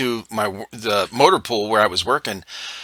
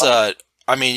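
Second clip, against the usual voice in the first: normal talking voice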